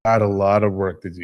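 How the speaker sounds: ordinary talk, nothing marked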